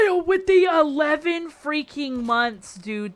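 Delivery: loud, high, stretched-out hype; excited shout-out. Real, not acted. Streamer thanking an eleven-month subscriber, excited shout. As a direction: belt it out slowly, thrilled.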